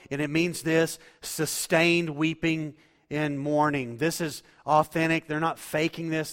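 loud, high impassioned preaching; fiery sermon delivery; preaching at full force. Acted. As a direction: preach it out full-voiced to the congregation.

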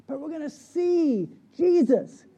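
"see" stretched out, loud and high; slow, emphatic build onto "see Jesus"; stress on see. Real, not acted. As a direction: proclaim it, dwelling on "see". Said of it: "I want to emphasize the word see".

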